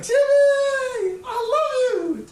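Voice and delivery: high, loud, stretched-out mock scream; woman fan yelling. Woman in the audience to her idol, high drawn-out yell. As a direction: imitate an adoring fan hollering at the stage. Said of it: "people to yell things from the audience".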